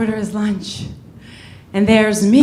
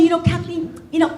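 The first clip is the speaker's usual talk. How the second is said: anxious nebbish; 3 semitones higher, 4 dB quieter than usual. Retold or imitated. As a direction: confess it like a nervous wreck. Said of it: "he tells me".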